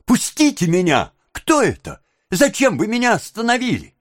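put-on high, alarmed child's voice; struggling boy's cry. A narrator's reading. Oliver grabbed in the street, alarmed cry. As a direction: protest high as you fight free. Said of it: "отбиваясь, крикнул Оливер".

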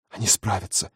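urgent soft whisper; heated whisper. Acted. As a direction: whisper it passionately. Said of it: "жарко шептала девушка". (горячий шёпот)

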